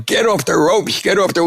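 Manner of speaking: high-pitched mock yell from the corner. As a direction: call it up to the fighter on the ropes. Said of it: "the Brain Trust is yelling".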